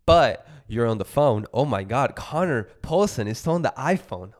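loudly busting his friend for phone use; mock outrage. Catching his cohost on the phone mid-podcast, loud mock outrage.

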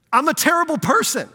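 loud, high-pitched mock blurt; imitating nervous blurting. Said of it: "he's so nervous".

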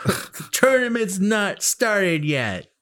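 high, slow mock announcement; mock stern. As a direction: drawl it like a fussy referee. Mocking Raiden's rule.

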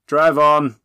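loud, high guard's shout; called out. A narrator's reading. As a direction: holler the command to move off. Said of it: "a guard called".

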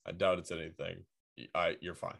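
low, soft brush-off; quietly unbothered retold reply; dismissive. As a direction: wave the worry away in a low, quiet voice. Manager brushing off the worry.

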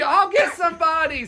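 high, loud imitation of a distant reply; calling back. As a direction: holler up to someone on a balcony. Woman calls back up from street.

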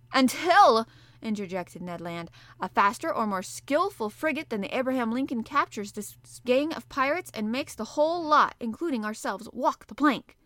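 the harpooner cutting in on Conseil; defiant harpooner. Acted. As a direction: burst in with a grim boast. Ned Land voiced.

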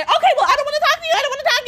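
high, fast whiny imitation of the roommate; mocking roommate. Mocking the roommate who refuses to talk, high and whiny. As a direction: parody her refusal in a high, bratty whine.

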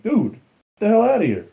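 mock outburst. Acted retort in a story.

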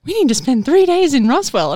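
imitating her excited sister-in-law's Roswell plan; eager sister-in-law. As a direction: push eagerly for the Roswell trip. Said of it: "she was like".

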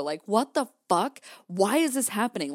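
mildly exasperated, pitch lifted; mock outrage at how things are going; retold anger. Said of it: "and I was mad".

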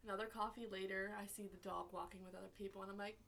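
ordinary delivery

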